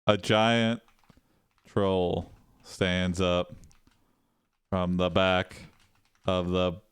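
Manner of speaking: low, halting narration with long gaps; slow, soft, word-by-word delivery; slow dictation. Real, not acted. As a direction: dictate it piece by piece as if typing. Dictates the story prompt while typing.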